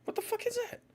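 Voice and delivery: very high, soft squeak; small high voice, puzzled; hushed baffled falsetto. Real, not acted. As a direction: squeak it quietly to yourself, bewildered. Baffled by a mysterious ticking sound.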